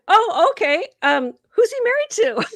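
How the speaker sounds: mock-breezy question to the father-in-law; fake casual. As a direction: hide the shock behind a light tone. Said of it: "I said".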